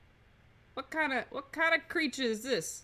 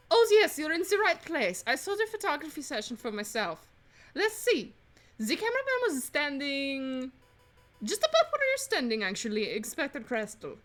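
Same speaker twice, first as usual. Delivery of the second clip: swooping French maid voice; French accent; 3 semitones higher than usual. Acted. Housemaid recalling where the cameraman stood, French accent. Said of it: "doing a French accent".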